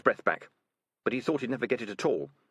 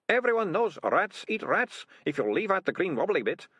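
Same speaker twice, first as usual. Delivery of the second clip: high nervous whining excuses; whiny ratcatcher; 11 semitones higher, 3 dB louder than usual. A narrator's reading. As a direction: babble excuses, high and anxious. Ratcatcher Two voiced.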